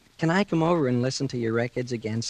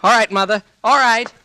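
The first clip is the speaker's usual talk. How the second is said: calling back; 10 semitones higher, 6 dB louder than usual. Acted. Son answering mother's call.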